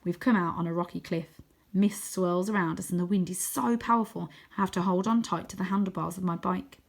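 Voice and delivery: ordinary delivery, nothing marked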